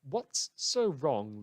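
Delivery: usual speaking voice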